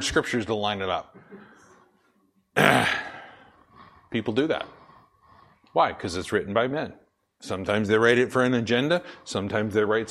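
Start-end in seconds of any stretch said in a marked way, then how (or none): none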